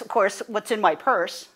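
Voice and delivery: ordinary talk, nothing marked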